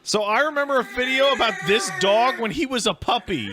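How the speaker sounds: talking through laughter; laughing. Real, not acted. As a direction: laugh while you say it. Laughing remembering puppy.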